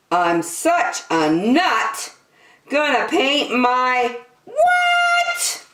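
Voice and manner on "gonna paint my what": slow, high, coy question; teasing cliffhanger. A narrator's reading. Reader teasing kids about a naughty rhyme, coy. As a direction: tease the missing word.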